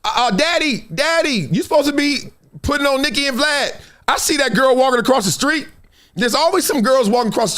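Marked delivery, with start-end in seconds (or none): 0.0-3.8 daughter's voice. Retold or imitated. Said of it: "My daughter be like".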